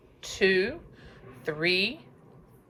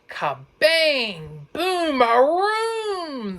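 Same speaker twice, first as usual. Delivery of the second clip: much higher, loud kabang, boom, aroom; thunder crash; 10 semitones higher, 4 dB louder, much slower than usual. A narrator's reading. Narrator to child listeners, loud high crashing thunder. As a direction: cry out the thunder.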